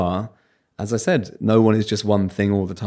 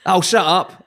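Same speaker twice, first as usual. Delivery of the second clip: dismissive bus driver; 10 semitones higher, 4 dB louder than usual. Retold or imitated. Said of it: "He went".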